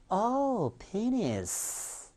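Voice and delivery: high, long falling "oh" of understanding; dawning realization, acted. Bystanders finally understand the doctor's word, acted drawn-out realization. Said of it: "그러니까 못 알아들어요".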